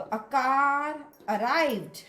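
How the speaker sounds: a car arrived slow, car r flowing into arrived; linking r. Shown as a demonstration. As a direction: sound the r of car before arrived. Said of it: "ये आर का pronunciation होगा".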